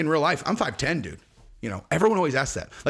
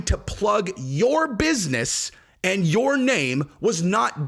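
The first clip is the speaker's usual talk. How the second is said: higher, slow, spelling it out; slow pointed argument; 3 semitones higher, slower than usual. Real, not acted. Comedian mocking the manager's logic, slow and pointed. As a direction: lay it out slowly to expose him. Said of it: "slow spelled-out argument".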